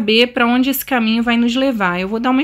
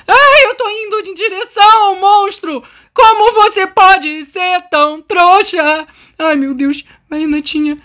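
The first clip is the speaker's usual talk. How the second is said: panicked self-scolding; 7 semitones higher, 5 dB louder, slower than usual. Real, not acted. Running toward the monster by mistake.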